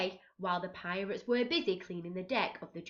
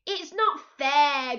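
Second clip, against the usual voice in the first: slow, louder sulky grumble; grumbling Pete; 6 semitones higher, 7 dB louder, slower than usual. A narrator's reading. Pete sulks about always being blamed, slow grumble. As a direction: sulk it out. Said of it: "grumbled Pete".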